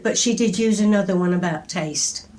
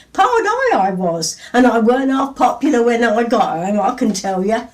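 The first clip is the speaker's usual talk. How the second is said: put-on broad Northamptonshire accent; 5 dB louder than usual. Poet voices her mother's friend's found poem, broad Northamptonshire accent. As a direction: retell the old Northamptonshire woman's banana tale in her broad accent, with big excited leaps. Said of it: "And in her broad accent, she said this".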